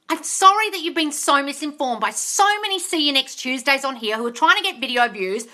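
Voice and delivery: ordinary talk, nothing marked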